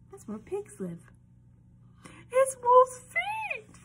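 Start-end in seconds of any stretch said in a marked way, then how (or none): 2.3-3.8 high, loud, drawn-out squeal; excited mock alarm. Reader pretends to children she has found Wolf's feet, excited squeal. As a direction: cry out slowly, far higher and louder. Feigned discovery in the book.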